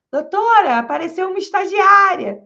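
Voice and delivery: high, loud excited call; calling out the news to the boss; retold shout. Intern shouting to the public defender, high and loud. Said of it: "Ela soltou um grito".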